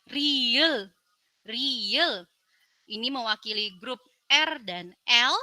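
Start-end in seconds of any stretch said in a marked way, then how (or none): 0.1-2.2 riel drawn out slowly; letter name demo. Shown as a demonstration. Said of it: "Lalu, riel".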